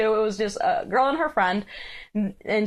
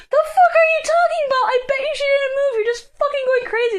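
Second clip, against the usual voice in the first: mocking disbelief; 4 dB louder, faster than usual. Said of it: "She's like".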